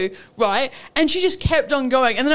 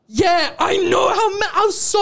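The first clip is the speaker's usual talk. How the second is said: exasperated; 6 semitones higher than usual. Real, not acted. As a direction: let frustration burst out. Exasperated retelling.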